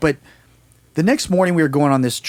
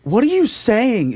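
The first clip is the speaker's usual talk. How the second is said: higher-pitched, drawn-out alarmed question; mock alarm, pitched up; growing alarm; 9 semitones higher, slower than usual. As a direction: imitate someone getting worried, stretching the words. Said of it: "She gets, like, visibly, like, concerned or fearful".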